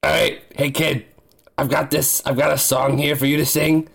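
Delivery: ordinary talk, nothing marked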